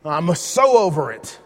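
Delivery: loud fed-up groan, then slow; exasperated parent. Retold or imitated. Parent fed up with bickering girls, exasperated groan. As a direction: sigh it out, totally done with them. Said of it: "And you're just like".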